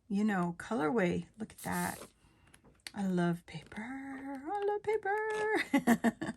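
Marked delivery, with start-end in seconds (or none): 3.8-6.3 very high, slow, drawn-out squeal; delighted squeal. Real, not acted. Crafter declaring a love of paper, delighted squeal. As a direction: stretch it out high in giddy delight.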